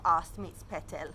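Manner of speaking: usual speaking voice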